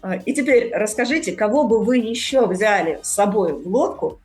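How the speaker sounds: ordinary talk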